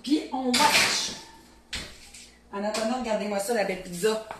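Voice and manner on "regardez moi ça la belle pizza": ordinary talk, nothing marked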